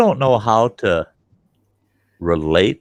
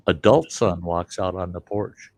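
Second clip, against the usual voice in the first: usual speaking voice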